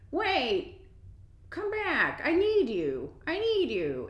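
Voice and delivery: high, swooping needy wail; mock pleading customer. Customer calling the departing employee back, mock pleading. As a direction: imitate a whiny customer calling someone back.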